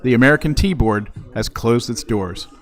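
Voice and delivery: loud mock press announcement; booming formal announcement, deadpan; announcer voice.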